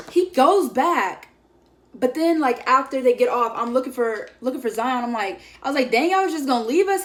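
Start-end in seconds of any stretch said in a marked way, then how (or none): 5.9-7.0 surprised. Retold or imitated. Finding friend left behind.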